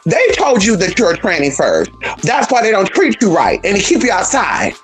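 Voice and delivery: ordinary delivery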